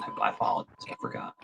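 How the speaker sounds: whispered fake apology; breathy sarcastic whisper; mock whisper.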